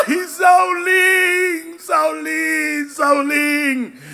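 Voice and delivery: slow, loud, wailing lament; hammed-up loud weeping over Saul; Samuel crying. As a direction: wail the name like a grieving prophet. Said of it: "cuando Samuel comienza a llorar".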